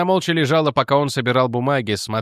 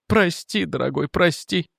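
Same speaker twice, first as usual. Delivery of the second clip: mildly sobbing; 5 semitones higher, slower than usual. A narrator's reading. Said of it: "всхлипывала она".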